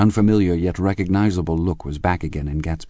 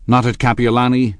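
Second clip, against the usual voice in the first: loud, raised challenging question; sudden demand; 6 semitones higher, 6 dB louder than usual. A narrator's reading. Tom challenges his wife's denial, suddenly demanding. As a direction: demand an answer abruptly. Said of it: "demanded Tom suddenly".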